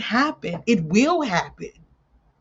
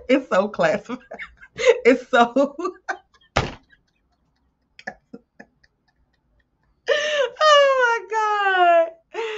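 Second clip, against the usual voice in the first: cracking up at a slip of the tongue; helpless laughter breaking up the words, pitch shooting high; real laughing fit; 12 semitones higher, 4 dB louder than usual. Real, not acted. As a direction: laugh too hard to finish the sentence.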